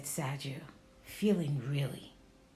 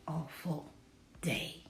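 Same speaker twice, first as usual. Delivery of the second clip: whispered; 2 semitones lower, 8 dB quieter than usual. A narrator's reading. Sergio sulking about his day, whispered. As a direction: whisper it, unvoiced and soft. Reading Sergio's gloomy line.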